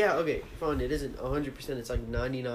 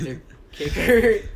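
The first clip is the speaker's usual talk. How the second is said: loud, slow disbelieving question; incredulous; 5 semitones higher, 10 dB louder, slower than usual. Real, not acted. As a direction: stretch it out in disbelief. Incredulous at the kicker excuse.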